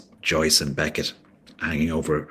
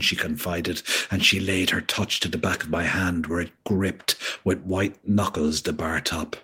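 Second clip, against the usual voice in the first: usual speaking voice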